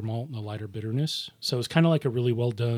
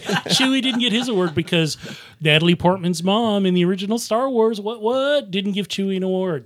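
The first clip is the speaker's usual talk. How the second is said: raised mock outrage; mock indignant; 8 semitones higher, 6 dB louder than usual. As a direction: act put out, a bit loud. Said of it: "I was like".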